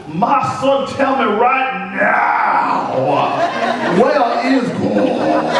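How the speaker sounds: loud, high, very slow yell; loudest sermon yell. A narrator's reading. Pastor yelling at the boy like a Sunday sermon. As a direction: preach it to the back pew. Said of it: "says in his loudest voice".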